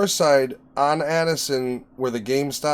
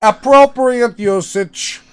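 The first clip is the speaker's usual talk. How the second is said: mock formal; 7 semitones higher, 7 dB louder, much slower than usual. Mock pronouncement.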